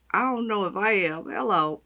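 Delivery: normal talking voice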